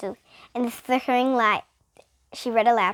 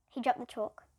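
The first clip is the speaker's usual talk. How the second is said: ordinary delivery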